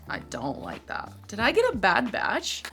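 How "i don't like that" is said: unvoiced whispered dislike; whispered aside. Real, not acted. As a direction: breathe it to yourself, no voice. Repeats her dislike under breath.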